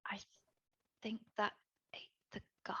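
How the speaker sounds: barely audible, halting whisper; hushed, broken-up dread; horrified whisper. Acted. Dread realization, then repeated aloud.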